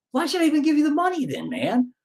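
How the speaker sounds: hammed-up disbelief; exasperated retort. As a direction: fire it back like it's absurd. Retold exasperated comeback.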